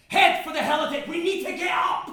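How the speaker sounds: high, urgent shouted orders; screaming. Acted. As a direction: bellow directions in an emergency. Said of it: "the stranger screams".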